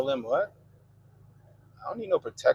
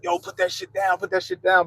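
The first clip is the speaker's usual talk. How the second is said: shouting; 6 semitones higher than usual. Retold or imitated. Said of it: "I'm like".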